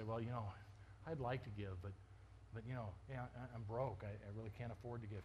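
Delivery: low, soft, hesitant mumbled excuse; sheepish excuse. Acted. Churchgoer explaining they are broke, sheepishly quiet. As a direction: beg off quietly, trailing away. Voicing people excusing themselves from giving.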